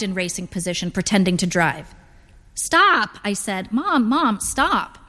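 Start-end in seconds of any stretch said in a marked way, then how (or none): 2.5-3.2 high, exasperated protest. A narrator's reading. Daughter to mother pretending to drive, exasperated. 3.7-5.0 higher, pleading exasperation; exasperated. A narrator's reading. Daughter to mother pretending to drive, exasperated.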